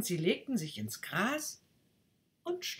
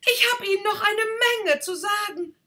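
fast, loud and much higher; urgent call; 11 dB louder, faster than usual. Acted. Rocket calls after the duck, fast and loud. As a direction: hurry to hold her back.

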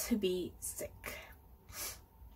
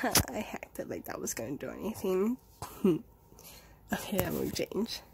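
laughing whisper; 3 semitones lower than usual. Real, not acted.

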